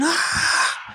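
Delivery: long breathy mock cheer; imitated crowd roar. Crowd reacting to the record announcement, imitated breathy roar. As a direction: exhale a high, voiceless stadium roar.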